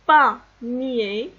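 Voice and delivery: "panier" split loudly into pa, nié; répétition découpée. Shown as a demonstration. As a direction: sound out "panier" for repeating. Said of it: "Alors on répète". (« panier » découpé fort, à répéter)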